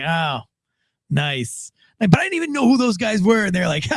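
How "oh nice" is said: underwhelmed. Retold or imitated. Retold deflated reaction to badges.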